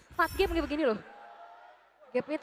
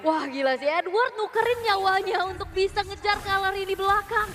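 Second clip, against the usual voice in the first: excited commentary; 7 semitones higher, 4 dB louder than usual. Real, not acted. Caster reacts to huge trade.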